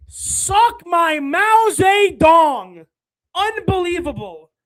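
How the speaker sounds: very slow, loud, stretched yell; enraged drawn-out shout; furious shout. Real, not acted. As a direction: bellow every word slowly. Streamer erupts at contract news.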